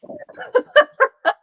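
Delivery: high-pitched amused laugh. Real, not acted. Lecturer amused by own aside about addresses, laughing.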